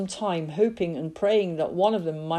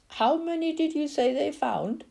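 put-on boy voice; 10 semitones higher than usual. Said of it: "Billy asked, blinking back the tears".

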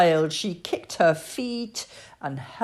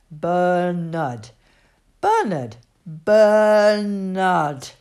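BERNARD called out, louder and drawn out on the last; mild narrated shout; 5 dB louder, much slower than usual. A narrator's reading. Bernard teaching Annie Rose his name, insistent call of Bernard. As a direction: repeat Bernard insistently, stretching the final one. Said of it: "he shouted".

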